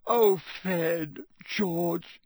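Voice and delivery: high, loud, tearful, slowing on the last words; sobbing. A narrator's reading. Mother sobbing over her twins, fearing the worst. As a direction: cry it out, full of guilt. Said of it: "starting to sob".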